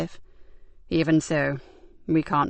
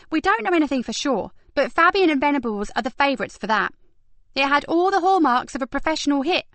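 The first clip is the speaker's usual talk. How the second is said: put-on lightly higher young woman voice; brisk higher detective voice; Kat voice; 7 semitones higher than usual. A narrator's reading.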